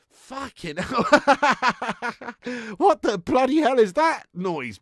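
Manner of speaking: high, loud shocked outburst; loud, high laughing shock. Real, not acted. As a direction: blurt it out in disbelief. Shocked by mascot.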